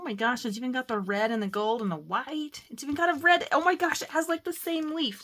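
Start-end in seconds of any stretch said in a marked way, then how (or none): 2.7-5.2 higher, louder, rushing delight; excited gush. Real, not acted. Crafter thrilled the paper has the same leaf, higher and louder. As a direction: gush about the match. Excited about matching leaves.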